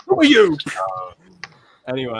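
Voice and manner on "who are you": much higher, louder demanding cry; hammed-up movie yell; mock shout. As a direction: bark it at the stranger. Retelling a film line.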